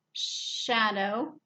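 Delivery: usual speaking voice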